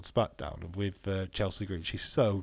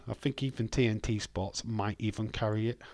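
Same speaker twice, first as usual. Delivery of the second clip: ordinary delivery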